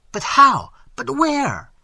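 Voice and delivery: high, loud, bewildered questions; stammered surprise. A narrator's reading. Mervale confused at his friend's rescue, astonished outburst. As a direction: burst out baffled, pitch shooting up. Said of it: "stammered Mervale, in great confusion and surprise".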